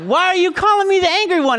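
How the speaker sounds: high, squeaky replay of himself; mock himself as a frazzled dad; mock exasperated dad. Dad retelling telling his son, high comic squeak. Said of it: "I was like".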